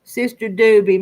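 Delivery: normal talking voice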